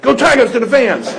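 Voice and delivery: loud Cajun yell; Ed Orgeron shout. Retold or imitated. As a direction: bellow it out the window. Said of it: "channeling Ed right now".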